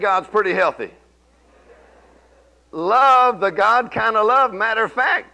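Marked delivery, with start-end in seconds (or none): 2.7-5.3 preaching at full force. Acted.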